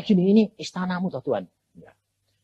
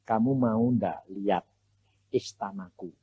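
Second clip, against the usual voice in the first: voice of God; 9 semitones lower, 8 dB quieter than usual. Retold or imitated. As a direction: speak down gently as the Lord. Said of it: "Terus Tuhan bilang".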